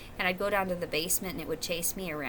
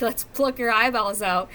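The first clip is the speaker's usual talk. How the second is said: mock menacing threat; 2 semitones higher, 8 dB louder than usual. Imagined people threatening her.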